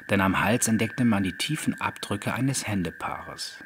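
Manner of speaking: plain reading voice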